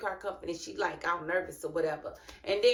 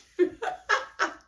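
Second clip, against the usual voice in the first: high airy laugh at her own joke; breathy burst of laughter; 12 semitones higher than usual. Real, not acted. Laughing at her own punchline about the wife.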